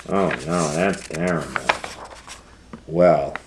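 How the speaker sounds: ordinary delivery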